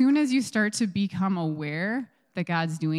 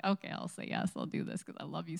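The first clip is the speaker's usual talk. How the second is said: whispered, much softer loving promise; soft tender yes; 9 dB quieter, faster than usual. Pretend. Saying yes to God out of love, soft and tender. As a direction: hush it like a vow to someone you love.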